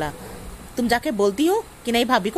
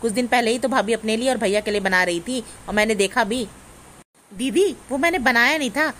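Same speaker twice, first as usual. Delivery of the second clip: ordinary talk, nothing marked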